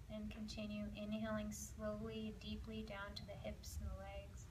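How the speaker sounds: hushed relaxation cue; guided breathing. Acted. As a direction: ease the class down into the hips and legs.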